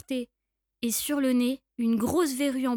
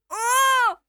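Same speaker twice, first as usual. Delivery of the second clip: high, loud startled "oh"; shocked gasp; 9 semitones higher, 7 dB louder than usual. Acted. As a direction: cry out in sudden shock. Mouse sees the monster.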